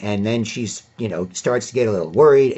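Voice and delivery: ordinary talk, nothing marked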